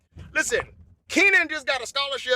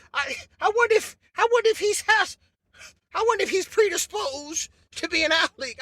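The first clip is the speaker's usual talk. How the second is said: higher, sly wondering tone; mock musing parent; 4 semitones higher than usual. Parent musing on athletic potential, sly and higher. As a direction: wonder aloud with feigned innocence.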